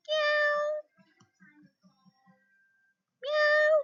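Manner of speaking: high playful meow like a cat; teacher mewing for a child. Pretend. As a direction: mew it high like a kitty.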